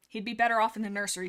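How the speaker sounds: ordinary delivery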